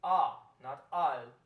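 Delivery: al said slow and drawn out, the A held open; open A sound. Shown as a demonstration. Choir teacher showing the altos how to shape the vowel. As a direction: sing al with a long open A. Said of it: "we're going to sing".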